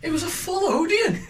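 mocking. Mocking his earlier excuse.

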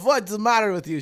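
put-on high, loud Ukrainian accent. Teacher scolding the pupil, loud Ukrainian accent. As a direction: demand it in a shouted Ukrainian accent. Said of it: "And she goes".

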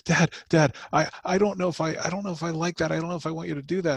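put-on high, unsure little boy's voice; a small child, hesitant and worried; voicing young son. Father voicing his five-year-old son, high and unsure. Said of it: "he goes".

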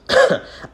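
high burst of embarrassed laughter; awkward laugh. Real, not acted. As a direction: laugh at your own stumble. Embarrassed at her own reading.

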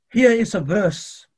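usual speaking voice

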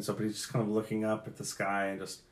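ordinary talk, nothing marked

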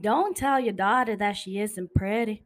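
southern accent, steadier repeat; southern accent, another take. Shown as a demonstration. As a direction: redo the southern line. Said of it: "Again, again, again".

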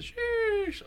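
long falsetto squeal; piercing drawn-out sheesh; high-pitched sheesh. Shown as a demonstration. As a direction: stretch it out very high, meme style. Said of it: "to get that pitch on it".

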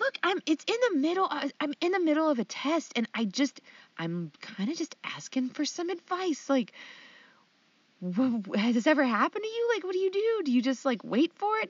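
high, fast and desperate; retelling herself begging mom for advice, frantic; frantic plea. Retold or imitated. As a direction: rush it out like a panicked student. Pleading with mom, retold.

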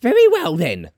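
high-pitched outburst; crying out. Acted. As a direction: cry it out high, dropping on the last word. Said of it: "cried the woman".

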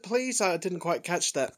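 ordinary talk, nothing marked

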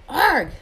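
sudden high, loud yelp; sharp pained arg. Acted. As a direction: cry out as the boot is bitten. Dragon bites Hagrid's leg.

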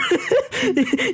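a sudden squealing laugh breaking the act; laughing at his own bit; high burst of laughter. Real, not acted. Laughing at his own Dahmer bit, high squealing laugh.

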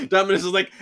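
ordinary delivery

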